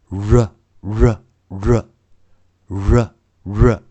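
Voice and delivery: "R" as a slow, separate ruh; initial phonics r. Shown as a demonstration. Teacher models R as a first sound, emphatic ruh. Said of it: "在国外我们都是这样教".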